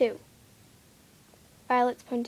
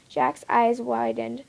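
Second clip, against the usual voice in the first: plain reading voice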